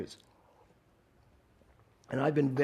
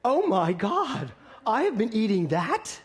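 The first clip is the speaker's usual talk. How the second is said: slow, high "oh my God" in retold shock; stunned at the calorie count; shocked gasp; 5 semitones higher, 4 dB louder, slower than usual. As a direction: draw out the dismay at the menu. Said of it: "And I'm like".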